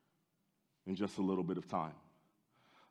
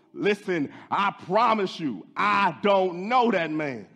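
loud, high insistent oath; swearing denial; 9 semitones higher, 9 dB louder, slower than usual. Acted. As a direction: swear it with full force, slowing at the end. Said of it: "denied it again with an oath".

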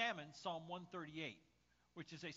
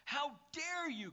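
high, loud, drawn-out royal outrage; theatrical slow bellow; indignant shout; 10 semitones higher, 6 dB louder, slower than usual. Acted. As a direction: shout in affront like a king. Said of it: "Canute shouted".

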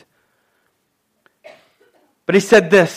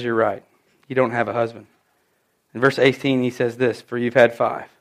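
much lower and quieter, gentle; a hushed, low, tender reading of Jesus' words; soft Jesus quote; 10 semitones lower, 9 dB quieter than usual. Retold or imitated. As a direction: say it softly, like a gentle confession of truth.

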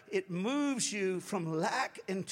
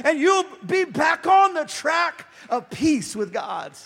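higher, loud pulpit call; full-force sermon climax; fiery preaching; 5 semitones higher, 9 dB louder than usual. Acted. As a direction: rouse the church to praise.

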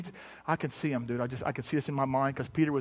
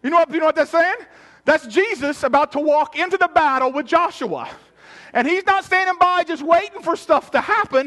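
loud, high, driving sermon delivery; full-force preaching; 10 semitones higher, 10 dB louder than usual. Acted. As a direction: preach it loud, pitched well up.

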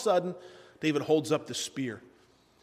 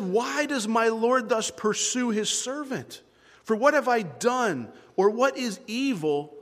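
slow, raised, emphatic appeal; earnest pleading; 6 semitones higher, slower than usual. Acted. David asking the king why he pursues him, pleading. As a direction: plead the questions slowly and high.

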